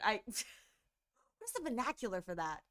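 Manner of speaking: normal talking voice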